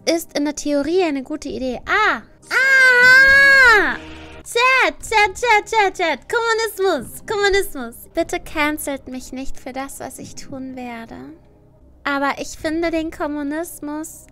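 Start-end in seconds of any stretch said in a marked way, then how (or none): 1.8-3.9 high shrieking cry; excited shriek. Real, not acted. Reacting to communism question. 4.4-7.9 high, loud calls to chat; excited calling out. Real, not acted. 8.1-14.3 slow and careful. Real, not acted. Cautious confession to chat.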